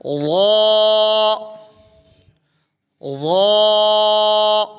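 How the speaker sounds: ظا said thick and loud, filling the mouth; heavy ظا in recitation; full-mouthed ẓā. Shown as a demonstration. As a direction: let ظا fill the mouth. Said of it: "منہ بھر جائے".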